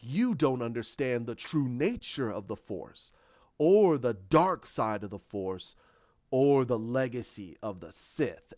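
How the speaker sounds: low, slow, weighty phrases; lightly acted grandiose dark master; retold villain's lecture. Snoke lectures Sidious, retold.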